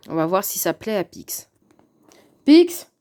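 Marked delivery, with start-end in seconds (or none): 2.4-2.9 loud high call to the cat. Real, not acted. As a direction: call out across the room to the cat. Calling the cat over.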